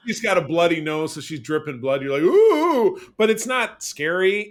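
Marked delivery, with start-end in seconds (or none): 2.2-3.0 high, loud mock gasp; a hammed-up spooky ooh; mock scared ooh.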